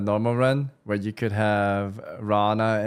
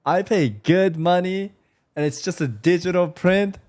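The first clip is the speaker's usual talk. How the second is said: excited rant; 7 semitones higher than usual. Real, not acted. Ranting about digital-only games.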